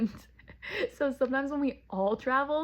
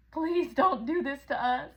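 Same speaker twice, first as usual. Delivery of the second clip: higher, slow whiny plea voicing the dog; pleading dog voice; 5 semitones higher than usual. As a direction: beg like a sad puppy. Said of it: "He's like".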